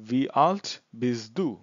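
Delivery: very slow, word-by-word German; slow language demo. Shown as a demonstration. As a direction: space each word out for learners. Teacher models German question. (শব্দে শব্দে খুব ধীরে)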